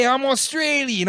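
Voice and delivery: very high-pitched fake Australian accent; hammed-up mock Australian; bad Australian accent. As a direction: put on an exaggerated Australian voice. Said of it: "I didn't know you were Australian".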